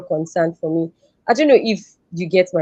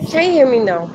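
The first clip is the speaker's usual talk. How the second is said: speaking up; 5 semitones higher than usual. Real, not acted. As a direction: raise your voice so they can hear. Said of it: "[name], speak up".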